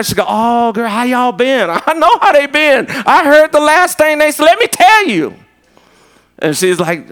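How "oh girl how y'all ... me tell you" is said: high, animated mock girlfriend voice; hammed-up excited girl talk; gossipy girlfriend impression. Acting out a greeting and gossip.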